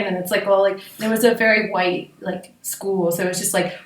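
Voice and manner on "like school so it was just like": normal talking voice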